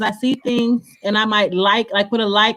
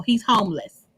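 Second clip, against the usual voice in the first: normal talking voice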